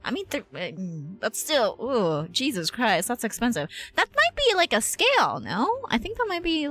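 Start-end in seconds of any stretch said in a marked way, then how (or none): none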